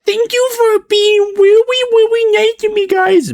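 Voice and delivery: high, sugary, teary fake-sweet voice; mock a tearful cutesy streamer; fake cutesy girl voice. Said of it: "doing the ... bit".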